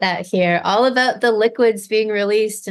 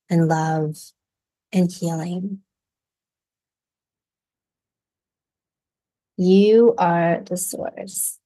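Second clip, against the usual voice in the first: guided meditation. Acted. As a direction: gently guide listeners through the visualization. Leads a guided visualization.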